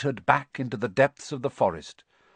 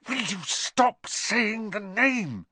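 high, slow, exasperated hiss; 12 semitones higher, slower than usual. A narrator's reading. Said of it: "Ron hissed".

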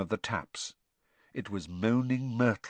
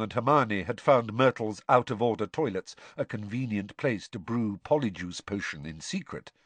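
ordinary delivery, nothing marked

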